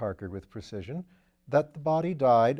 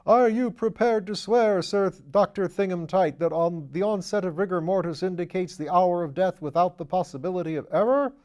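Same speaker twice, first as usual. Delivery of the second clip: hammed-up prosecutor cross-examining a doctor; mock barrister; 6 semitones higher, 6 dB louder than usual. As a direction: play the bullying counsel at full pomp. Wimsey mimics cross-examining counsel.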